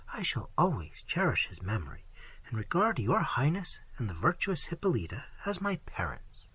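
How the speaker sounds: put-on soft, hushed young lady; a frightened girl answering carefully, half whispered; Isabella, timid and soft. Isabella's soft guarded reply.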